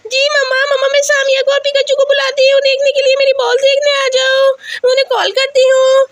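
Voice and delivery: put-on high, excited child voice; high-pitched childish eagerness; eager little girl.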